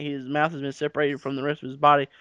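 normal talking voice

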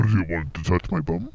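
ordinary talk

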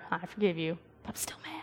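soft forced apology, then the last words whispered; grudging then whispered. Retold or imitated. Forced to forgive her sister, softly, then whispering. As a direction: say it for Mama, then whisper the truth behind her back. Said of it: "Say that once she turned around".

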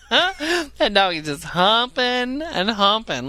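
high, slow, laughing disbelief. Real, not acted. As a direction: marvel at it, cracking up. Laughing at the dog.

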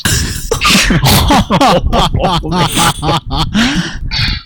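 high, long helpless laughter. Real, not acted. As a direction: laugh till you can't stop. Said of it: "me voy a quedar sobado riéndome". (risa larga y aguda)

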